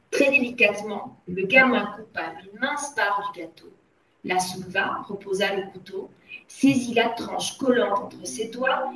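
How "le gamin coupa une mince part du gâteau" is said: usual speaking voice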